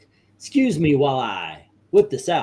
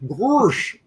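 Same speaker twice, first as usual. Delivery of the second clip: goofy cartoon dog voice, pitched up; 8 semitones higher than usual. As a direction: do a quick Goofy "gorsh". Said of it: "I can actually do Goofy".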